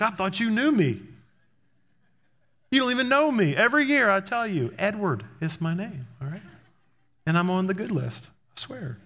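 put-on high-pitched, wounded kid protest; hammed-up childish indignation; indignant child. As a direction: plead your case like a slighted little boy. Child protesting to Santa in story.

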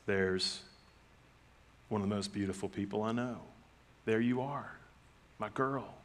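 soft, hushed and loving; tender welcome. Retold or imitated. Dad welcoming his youngest softly and tenderly. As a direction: speak gently to your baby girl. Greeting baby daughter.

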